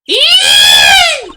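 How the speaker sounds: very high, loud acted squeal; terrified shriek. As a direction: shriek like a frightened girl. Girl character finds a body.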